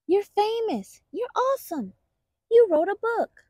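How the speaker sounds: high, squeaky thrilled bunnies; excited baby bunnies. Acted. Baby bunnies cheer for Titu's own book, high and thrilled. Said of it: "the baby bunnies were beside themselves".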